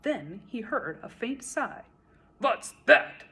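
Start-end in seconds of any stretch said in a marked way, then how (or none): 2.4-3.2 sharp, loud snap; snapped. A narrator's reading. King snapping at the turtle below. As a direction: bite the question off like an angry king. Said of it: "snapped the king".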